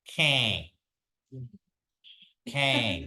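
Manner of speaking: Kang with a nasal rattle. Shown as a demonstration. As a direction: buzz Kang through the nose. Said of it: "you feel like a rattle in your nose".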